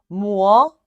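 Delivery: usual speaking voice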